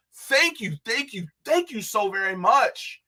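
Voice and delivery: gushing gratitude; excited thanks. Real, not acted. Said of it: "I am so happy".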